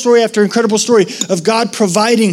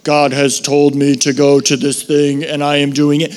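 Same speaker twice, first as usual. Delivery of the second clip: lightly robotic, low and flat-toned; a hint of a robot reciting orders; 6 semitones lower than usual. Preacher mocking churchgoers who obey God like robots, mild robot voice. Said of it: "it's like we're robots".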